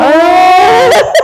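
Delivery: high, loud wordless cry; mock scream. Wrestler told the new teeth are wrecked, mock scream. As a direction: imitate a dismayed scream. Retold reaction to broken tooth news.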